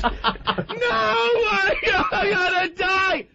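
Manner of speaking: high, drawn-out mock sobbing wail; hammed-up hysterical crying; imitated tearful panic. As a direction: wail like a passenger sure of doom. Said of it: "she's got tears bursting".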